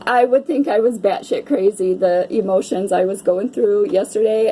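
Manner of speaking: ordinary talk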